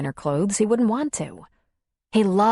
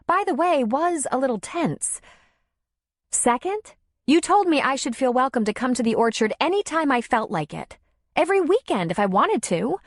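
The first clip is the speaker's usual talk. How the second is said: pitched up and indignant; hurt, defensive woman, higher; 7 semitones higher than usual. A narrator's reading. Darla pushing back at Tom, indignant and high. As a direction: push back as if insulted.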